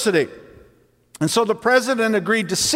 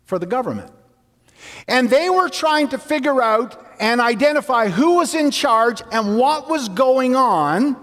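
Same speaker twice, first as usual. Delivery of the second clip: normal talking voice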